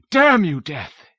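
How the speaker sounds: a high, loud scream of grief and rage. A narrator's reading. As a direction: scream at Death over his dead wife. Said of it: "he screamed".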